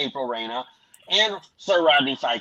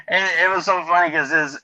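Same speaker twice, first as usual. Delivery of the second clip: ordinary talk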